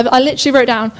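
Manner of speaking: usual speaking voice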